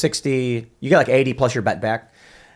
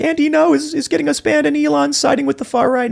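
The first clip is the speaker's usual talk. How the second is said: much higher, squeaky mock voice; mocking whiny activist. Tim mocks banned Antifa accounts complaining about Elon, squeaky mocking whine. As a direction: parody a panicking online mob. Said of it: "They're like".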